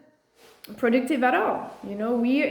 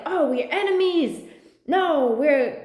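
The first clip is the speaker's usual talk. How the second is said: mock hostile politician; 5 semitones higher than usual. Quoting the president's side, mock.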